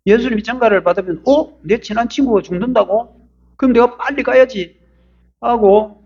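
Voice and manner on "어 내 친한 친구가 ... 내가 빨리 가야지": surprised reaction. Acted. Jesus voiced reacting to news.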